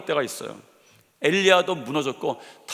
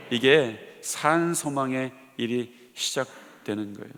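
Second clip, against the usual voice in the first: softer, slow benediction; quiet pastoral blessing; soft closing blessing; 5 semitones lower, 3 dB quieter, slower than usual. Acted. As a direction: gently bless the listeners.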